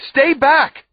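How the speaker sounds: loud, high warning yell; shout. A narrator's reading. Brother stopping his sister in the dark, shouting. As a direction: shout a sharp order to stop. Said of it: "I shout".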